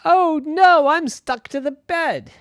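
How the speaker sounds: put-on high, child voice in alarm; a kid realising he's stuck; boy in dismay. Irwin discovering he's glued to his bed, high alarmed boy voice.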